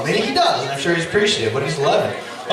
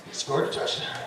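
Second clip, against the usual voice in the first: very soft, almost whispered disbelief; hushed awe; stunned hush; 3 semitones lower, 10 dB quieter than usual. Retold or imitated. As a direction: say it under your breath, stunned. Stunned dad, then celebrating.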